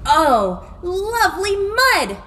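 delighted cow. A narrator's reading. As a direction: savour it like a happy cow. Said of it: "said the cow".

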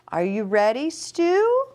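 ordinary delivery, nothing marked

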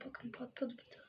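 very soft hushed whisper. Real, not acted. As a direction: whisper it close to the mic. Whispered aside about balloon.

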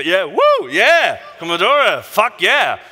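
loud, high whoops of approval; excited cheering. Real, not acted. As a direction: cheer along with the fans. Cheering along for the Commodore.

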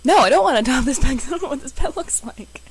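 high, loud, fast objection; loud protest. Real, not acted. Player balking at adopting an unseen pet, loud frantic protest.

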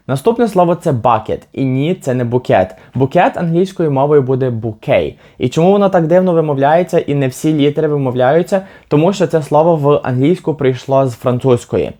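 ordinary talk, nothing marked

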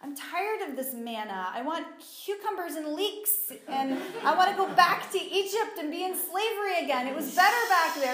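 grumble, then work up into a loud, high protest; hammed-up complaining. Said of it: "they just complained and complained and complained".

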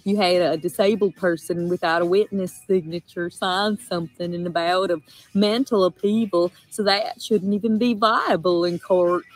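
loud, Southern-accented rant; accent slipping in mid-rant; sudden Southern accent. Real, not acted. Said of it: "Why the accent?".

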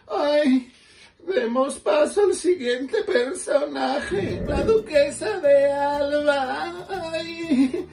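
put-on high, slow, laughing old duchess voice; Duquesa de Alba.

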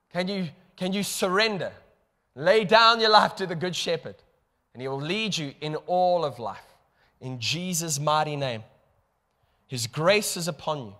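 ordinary talk, nothing marked

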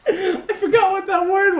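high-pitched, through laughter; laughing. Real, not acted. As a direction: squeeze the words out while laughing. Laughing at own word lapse.

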